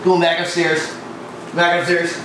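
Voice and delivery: ordinary talk, nothing marked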